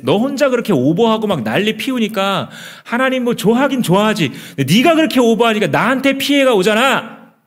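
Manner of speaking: high, loud, bitter ranting at a brother; jealous rant. Acted. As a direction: vent all the jealousy at your brother. Cain ranting at Abel. (높고 큰 소리로 동생에게 퍼붓는 질투)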